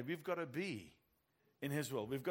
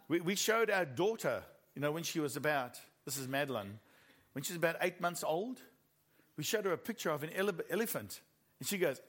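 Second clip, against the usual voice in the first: ordinary talk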